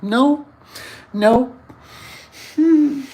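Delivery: high, drawn-out mock no; mock parent warning. Dad stopping himself with his kids, high mock no. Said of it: "you gotta be like".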